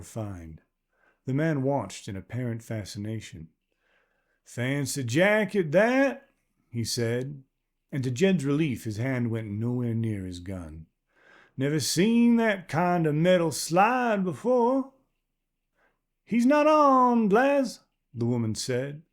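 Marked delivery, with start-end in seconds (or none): 4.4-6.3 put-on higher, rough old man with a near-Southern accent; a wary stranger's unplaceable accent; strange rural accent. Man voiced by narrator. 11.6-14.9 put-on higher, rough old man with a near-Southern accent; a wary stranger's unplaceable accent; strange rural accent. Man voiced by narrator. 16.2-17.8 much higher and louder, a well-used voice; worn old woman calling back. Acted.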